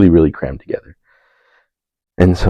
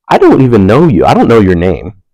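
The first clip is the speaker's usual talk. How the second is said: incredulous inner protest; 5 semitones higher, 7 dB louder than usual. Retold or imitated. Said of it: "I'm sitting there going".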